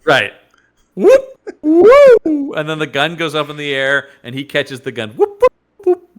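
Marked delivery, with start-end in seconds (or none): 1.0-2.5 cartoon falling whoop. Acting out a Looney Tunes fall. 5.1-6.1 cartoon whoops. Acting out a Looney Tunes catch.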